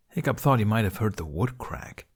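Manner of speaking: usual speaking voice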